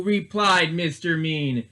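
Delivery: usual speaking voice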